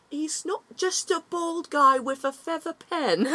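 a half-lost Geordie presenter impression; Philomena Cunk Newcastle accent. Imitating a satirical British presenter on Shakespeare, slipping Newcastle accent. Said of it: "That's not how she talks. I lost the accent".

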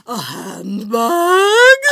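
high drawn-out grande dame "A handbag?"; Lady Bracknell. Retold or imitated. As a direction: boom it like an outraged grande dame. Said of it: "that's her voice".

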